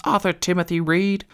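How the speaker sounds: a hint of a scolding mum; mum calling full name. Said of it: "heard his mum yell that at him".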